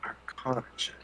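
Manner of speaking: breathy whispered word. Real, not acted. Player repeating the GM's call, whispered. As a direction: whisper the check name under your breath.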